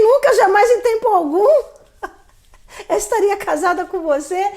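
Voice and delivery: emphatic inner retort. Retold or imitated. Inner retort to ex's proposal.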